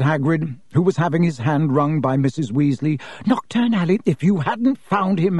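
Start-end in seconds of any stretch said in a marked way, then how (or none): 3.2-5.4 high, agitated motherly horror; frantic mother. A narrator's reading. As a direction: exclaim Knockturn Alley, appalled. Frantic mother thanking rescuer.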